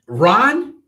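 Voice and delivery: host teasing co-host over dropped driver; teasing gloat. Real, not acted. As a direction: gloat as you grab his pick.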